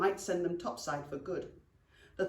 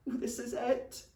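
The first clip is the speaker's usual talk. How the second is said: higher, doomed groan; 5 semitones higher than usual. Acted. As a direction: moan it as if the end has come. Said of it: "he groaned".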